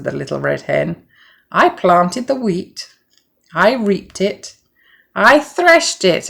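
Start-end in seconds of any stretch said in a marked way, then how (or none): 1.5-6.3 slow, each step stressed; scolding list. A narrator's reading. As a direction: lay it out slowly, firmly. Hen scolds lazy animals.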